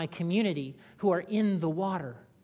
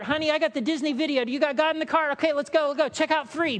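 mock hurried shopper; 8 semitones higher, 4 dB louder, faster than usual. Mock shopper grabbing God.

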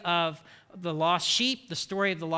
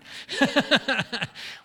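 amused laugh; 5 semitones higher, 4 dB louder than usual. Real, not acted. Joking about family gossip.

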